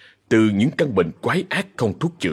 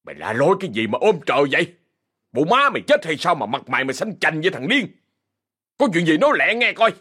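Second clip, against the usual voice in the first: snapping gruffly; 6 semitones higher than usual. Acted. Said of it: "gắt gỏng".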